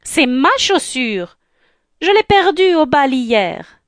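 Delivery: high, emphatic stepsister claim; insistent squabbling. Acted. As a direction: insist that it belongs to you. Younger sister claims the shoe. (insistance, voix aiguë)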